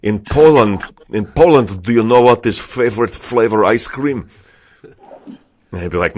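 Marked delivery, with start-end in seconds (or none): 0.0-4.3 put-on lightly done Polish accent, louder; a hint of a Polish joker, setting up a gag; light Polish accent telling a joke. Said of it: "like, ridiculous jokes".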